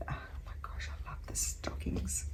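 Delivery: delighted hushed whisper. Real, not acted. Whispered delight over stockings.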